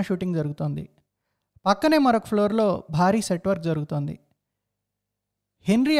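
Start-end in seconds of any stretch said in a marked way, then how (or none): none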